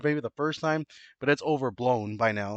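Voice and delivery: normal talking voice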